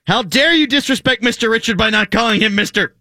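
theatrically affronted listener; mock outrage. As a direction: read it as a hammed-up telling-off. Reads out listener's outraged tweet.

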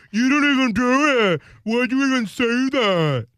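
high, loud imitation of an argument; whine-and-yell parody of a squabbler; mock arguing. Cohost imitating Scott in an argument, mock arguing. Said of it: "is that Scott in an argument".

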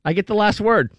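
mock spiteful mom. Voicing mother in obituary.

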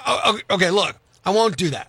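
normal talking voice

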